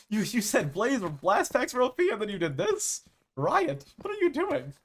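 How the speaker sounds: high-pitched incredulous complaint; mock outrage. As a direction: plead with the company in playful disbelief. Mock complaint addressed to game company.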